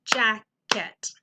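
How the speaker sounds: jacket in two separate beats, jack, ket; jack-ket, a short gap between the halves; beats within word. Shown as a demonstration. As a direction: say jacket as two distinct syllables. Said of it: "just the beat within the word".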